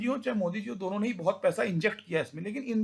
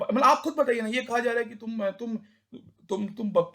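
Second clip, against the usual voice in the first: ordinary talk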